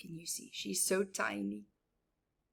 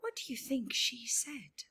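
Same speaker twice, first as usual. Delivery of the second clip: hushed question; 3 semitones higher, 5 dB quieter than usual. Real, not acted. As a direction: build suspense softly.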